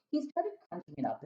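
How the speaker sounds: ordinary delivery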